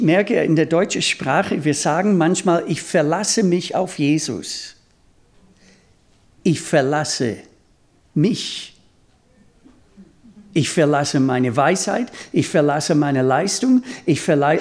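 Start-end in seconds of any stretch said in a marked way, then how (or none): none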